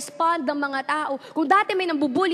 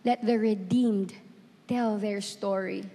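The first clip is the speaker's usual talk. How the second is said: slow, lower and softer quoted words; God's voice; 7 semitones lower, 5 dB quieter, slower than usual. Retold or imitated. Retelling what God told her, as a slow God's voice. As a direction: let each phrase land slowly and low. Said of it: "you know what God told me?".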